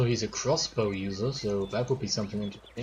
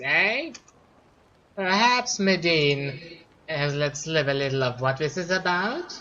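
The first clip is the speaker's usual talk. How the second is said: higher, louder, slower schemer wizard voice; scheming wizard; 5 semitones higher, 4 dB louder, slower than usual. Acted. Gamer voicing the wizard Tolgarius, sly and higher. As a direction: play a sly old wizard drawing him in.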